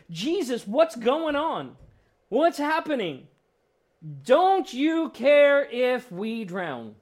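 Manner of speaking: loud, high, desperate cry for help; panicked shout. Acted. Disciples waking Jesus in the storm, panicked high shouting. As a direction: shout it like men about to drown.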